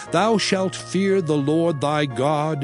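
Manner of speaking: ordinary talk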